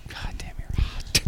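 breathless whisper, slowed down. Real, not acted. Cohost whispers to the guest how hot she is, whispered. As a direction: breathe it out unvoiced, slowly.

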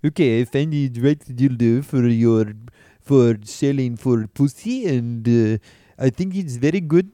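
a hint of a French helper in a French accent. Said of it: "'cause he's French".

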